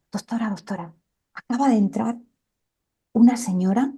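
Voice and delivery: weak, near-whispered voice of a very ill man; faint voice. Retold or imitated. Bedridden patient telling the doctor about a visitor, faintly. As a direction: push the words out with almost no voice. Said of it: "con la voz muy nimia".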